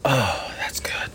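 breathy whisper after a long drink; whispered relief. Real, not acted. After a gulp from his jug, a whispered sigh of relief. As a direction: sigh it out, refreshed.

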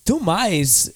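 halting delivery with odd pauses; swooping, stop-start cadence; Christopher Walken. Acted. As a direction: speak in broken phrases, each peaking then dropping. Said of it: "let's hear Christopher Walken first".

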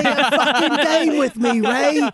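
high, loud Cockney gangster voice; menacing Cockney gangster snarl; Cockney accent. Retold or imitated. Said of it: "get [name] to do a Cockney accent".

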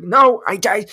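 high, loud stammer; too worked up to get it out; flustered. Retold or imitated. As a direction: splutter in mock panic. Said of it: "now I'm all flustered".

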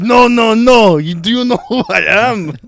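put-on very loud, high French-accented protest; mad French accent. French fan rejects his apology, mock-furious French accent. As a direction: refuse angrily in a hammed-up French accent. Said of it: "they were both so mad".

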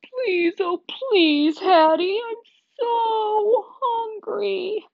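high, drawn-out begging whimper; hammed-up moaning plea, stretched long. A narrator's reading. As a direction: pathetic whining dog, pleading hard. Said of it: "I whimper and moan".

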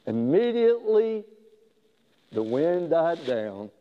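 usual speaking voice

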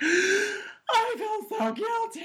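high, very slow, laughing fake sob; hammed-up teary little girl; mock bawling child. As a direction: imitate a kid wailing in guilt. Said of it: "like, bawling".

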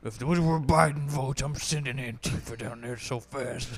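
gruff put-on boss voice; put-on character voice. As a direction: stay in the odd boss voice.

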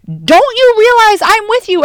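high, loud shouted comeback; yelling back. Acted. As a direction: shout it right back over the storm. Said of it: "like, back at them".

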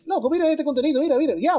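high, hyped street voice; dominican accent. Retold or imitated. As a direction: push the clips on them, pitched high. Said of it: "fuera Henry".

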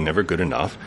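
usual speaking voice

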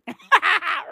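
short high laugh. Real, not acted. Laughing at own joke.

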